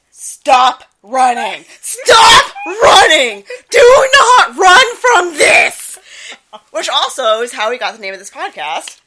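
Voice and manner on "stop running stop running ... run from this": full-blast drawn-out shout, each word stretched; imitated yelling. Co-host mimics ghost hunter chasing his fleeing friend, yelling. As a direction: bellow it slowly as a command, rising in pitch. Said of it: "Zach is running behind him, going".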